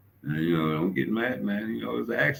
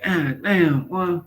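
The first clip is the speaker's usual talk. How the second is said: high put-on voice; lightly voiced awed friend; high mock admiration; mock impressed homie; 13 semitones higher, 4 dB louder, much slower than usual.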